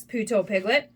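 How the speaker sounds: ordinary delivery, nothing marked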